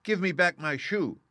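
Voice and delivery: put-on high, loud, indignant little girl; angry child's demand. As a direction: demand the shoe back, upset. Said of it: "grew angry and said to the witch".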